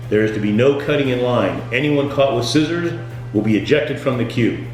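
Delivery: normal talking voice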